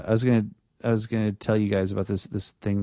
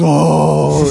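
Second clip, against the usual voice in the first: loud, thrilled exclamation; excited oh; 3 semitones higher, 8 dB louder than usual. Retold or imitated. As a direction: jump at the scary part. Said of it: "And Lance goes".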